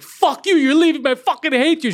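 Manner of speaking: high, loud mock outburst at her son; imitated screaming mother. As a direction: act out a mom's furious yelling. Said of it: "And then they start screaming".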